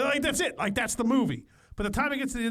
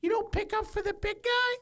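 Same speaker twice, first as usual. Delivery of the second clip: much higher put-on mom voice; mock mom asking in a high pitch; imitation of mom; 12 semitones higher than usual. Son quoting mom on FaceTime, high put-on voice. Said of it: "She's like".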